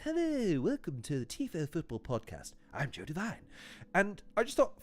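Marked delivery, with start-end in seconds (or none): 0.0-3.4 a light podcast-host intro voice, softer; Joe Devine impression. Vlogger mimicking a podcast host introducing the show, soft. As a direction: ease into a hushed host welcome. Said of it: "doing my Joe Devine impression".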